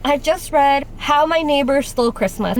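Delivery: usual speaking voice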